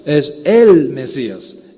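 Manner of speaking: EL punched loud and drawn out; stress on EL. Real, not acted. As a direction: lean hard and slowly on "el", the one and only. Stresses the article for uniqueness. (énfasis fuerte y alargado en EL)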